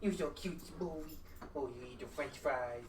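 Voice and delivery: normal talking voice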